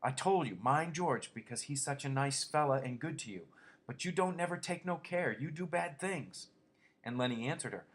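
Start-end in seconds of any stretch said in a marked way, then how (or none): 0.0-6.5 put-on higher scolding old-woman voice; scolding aunt. A narrator's reading. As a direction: nag him like a stern aunt. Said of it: "she went on in Lenny's voice".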